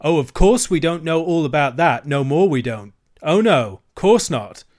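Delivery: scornful dealer. Acted. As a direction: sneer at the children. Dealer berates the children.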